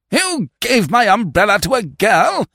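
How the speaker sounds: high, incredulous outrage; scandalised young club man squawking; aghast. Acted. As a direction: repeat the news in horrified disbelief. Youth is aghast at news.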